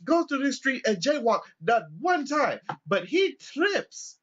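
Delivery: high, loud offended grandpa; the old man challenging the cop, high and loud; indignant old man. Retold or imitated. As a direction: sound scandalised and high. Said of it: "he's just like".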